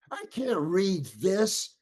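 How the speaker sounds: high-pitched exasperated protest; worked up. Retold or imitated. Actor protesting to secretary about ninety pages, worked up. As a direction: retell a panicked complaint, pitch climbing. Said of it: "I'm, I'm really getting worked up".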